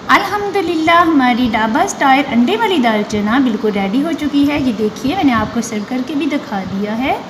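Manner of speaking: bright, loud outro pitch to viewers; loud vlog sign-off. Real, not acted. Host cheering the finished dal to viewers, loud. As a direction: proclaim the finished dish loudly.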